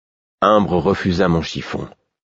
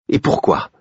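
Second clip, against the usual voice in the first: defiant. A narrator's reading. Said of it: "lançai-je avec défi".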